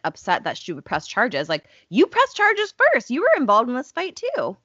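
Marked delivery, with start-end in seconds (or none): 1.9-4.6 high, loud exasperated rant; indignant outburst. Real, not acted. Ranting about Candace pressing charges first, indignant and high. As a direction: let the outrage spike up high.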